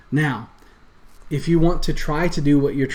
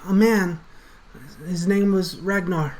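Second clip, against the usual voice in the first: higher, pleading and scared; frightened pleading; 6 semitones higher than usual. Acted. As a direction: beg for your life. Rat NPC answers.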